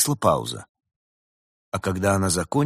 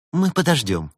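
high, eager assurance; 8 semitones higher, 5 dB louder than usual. A narrator's reading. Woman in love offers to wait, eager. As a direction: assure them readily, pitch raised. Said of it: "с готовностью заверила влюблённая".

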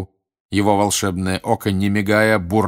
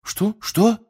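high, puzzled double question; high-pitched bewildered query; baffled; 13 semitones higher than usual. A narrator's reading. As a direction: squeak it out, not understanding. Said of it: "не понял Рон".

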